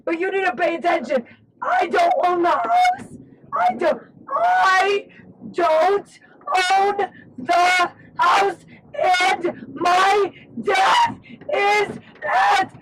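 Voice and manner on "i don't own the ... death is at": hoarse cracking shout. Real, not acted. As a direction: shout with a lost voice. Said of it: "I'm sorry my voice is gone".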